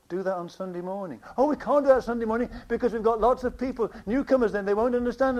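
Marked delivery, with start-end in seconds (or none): 1.4-5.4 fast, higher-pitched protest; mock objection. Preacher voicing people objecting to Sunday morning silence, hurried protest. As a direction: rattle off the excuse in a raised voice.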